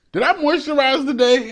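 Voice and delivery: high, startled asking; surprised self-question. Retold or imitated. Man checking his dry legs, startled high question. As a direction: catch yourself wondering.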